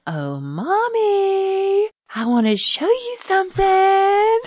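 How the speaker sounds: put-on child teasing mom from the living room, high and slow; sing-song child voice. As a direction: call out like an eager little boy. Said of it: "and he'll go".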